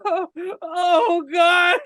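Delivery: high-pitched mock-terrified moan through laughter; hammed-up horrified gasp; mock dread. Fan acting out a movie character's shocked reaction, mock dread. Imitates frightened onlooker's reaction.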